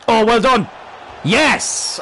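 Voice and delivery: loud, high cheer; real jubilant outburst; elated goal celebration. Real, not acted. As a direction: shout it as the goal goes in.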